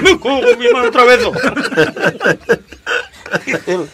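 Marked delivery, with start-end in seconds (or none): 0.0-1.2 much higher, louder exasperated curse; loud laughing curse. Acted. Lover finds the boy again, loud laughing curse. 1.2-3.4 real burst of high laughter. Real, not acted. Teller laughing at his own joke, high laugh.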